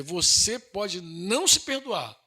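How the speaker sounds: usual speaking voice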